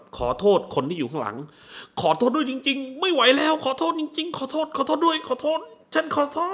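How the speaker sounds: high, pleading, repeated sorry; desperate apology. Retold or imitated. Bowing man begs those behind him, high and pleading. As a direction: beg forgiveness in a strained high voice. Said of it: "ยกมือไหว้เหนือหัวอย่างงี้แบบ".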